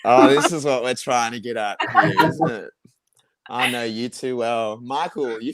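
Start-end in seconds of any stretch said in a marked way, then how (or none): 0.0-2.3 amused, laughing through words. Real, not acted. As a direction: laugh while catching on. Laughs at teasing dating question.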